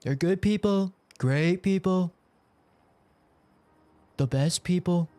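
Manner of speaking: hammed-up Trump rally voice; Trump impression. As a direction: build the praise up like a rally speech. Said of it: "I just know he says China".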